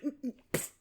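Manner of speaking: put-on sulky kid noises. Ghost kid told no, mock sulky huffing noises. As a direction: huff wordlessly like a thwarted child. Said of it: "and it's like".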